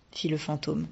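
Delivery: ordinary delivery, nothing marked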